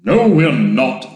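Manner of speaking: slow, loud denial; drawn-out emphatic no; loud contradiction. A narrator's reading. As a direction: contradict firmly at full voice. Shouted contradiction among crew.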